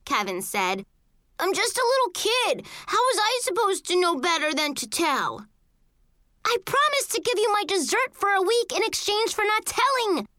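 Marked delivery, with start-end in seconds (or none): 1.4-5.4 put-on high, pleading kid's voice; protesting little kid. A narrator's reading. Blamed little brother protests. 6.5-10.3 high-pitched angry accusation; indignant yelling. A narrator's reading. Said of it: "I yelled".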